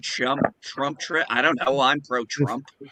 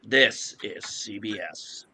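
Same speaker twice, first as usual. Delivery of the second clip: slow, low announcer voice; deep, slow network ident; James Earl Jones voice; 4 semitones lower, 7 dB quieter, much slower than usual. Retold or imitated. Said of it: "We also have James Earl Jones".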